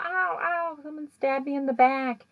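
high, pained yelps; freaking out. Retold or imitated. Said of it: "he's, like, freaking out".

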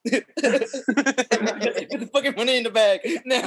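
laughing mock robber demand; bank robber. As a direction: bark the demand while cracking up. Acted robber yelling, laughing.

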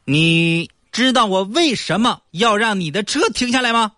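police officer. Acted. Police officer questioning driver.

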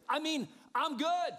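high, loud, stretched-out assurance; smug self-justification. Acted. Sinner with two excuses clears himself, high and loud. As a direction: swing way up and draw it out.

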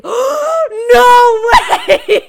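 high, loud gasped shriek; shocked reaction. Retold or imitated. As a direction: shriek it in giddy disbelief, half laughing. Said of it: "And I was like".

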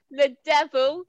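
ordinary talk

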